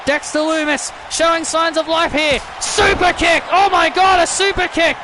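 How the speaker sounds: excited wrestling call, pitch climbing as the finish nears; urgent play-by-play. Acted. Commentator sensing a new champion, urgent play-by-play. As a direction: build to a high, shouted near-fall call. Calls a near finish live.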